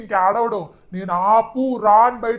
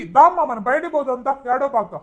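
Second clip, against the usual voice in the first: usual speaking voice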